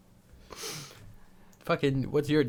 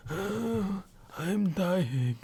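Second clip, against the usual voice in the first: put-on very old man; 4 semitones higher, 4 dB quieter than usual. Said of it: "old man voice".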